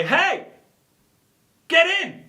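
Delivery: high-pitched holler to a passer-by; calling out. Acted. Driver pulls up to a stranger, high excited call. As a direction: shout it brightly across the street.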